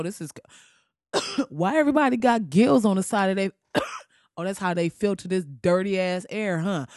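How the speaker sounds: normal talking voice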